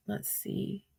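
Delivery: very soft, voiceless whisper; whispering to self. Real, not acted. Streamer searching through her texts, whispering to herself. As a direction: whisper it absently under your breath.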